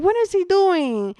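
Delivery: high, loud alarmed question; scared. Retold or imitated. Brother seeing his sibling cry, scared and alarmed. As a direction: imitate a frightened brother's outcry. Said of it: "they were scared".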